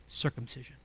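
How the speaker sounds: ordinary talk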